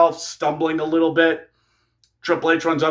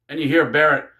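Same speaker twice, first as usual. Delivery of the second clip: ordinary talk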